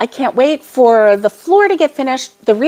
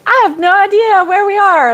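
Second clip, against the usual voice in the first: high-pitched retold wail of confusion; comically exaggerated cluelessness; mock clueless; 10 semitones higher than usual. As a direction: imitate yourself being hopelessly lost, pitched way up. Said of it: "I'm like".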